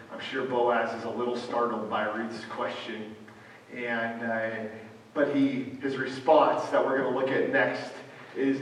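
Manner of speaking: usual speaking voice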